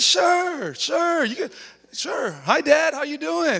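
high, loud, overly sweet son voice; hammed-up cheerful son greeting his new dad; mock eager son. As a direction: play the happy son for a stranger. Playing along as the son.

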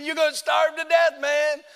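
high-pitched devilish taunt; acted gloating devil; mocking devil. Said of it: "He's mocking me and making fun of me".

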